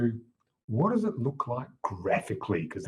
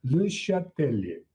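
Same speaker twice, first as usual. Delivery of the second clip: put-on ridiculous French accent. Teacher mimicking how the French would say it, ridiculous French accent. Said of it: "in a ridiculous French accent".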